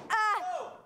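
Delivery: sudden high yelp of surprise; real startled cry. Real, not acted. Balloon pops in front of her.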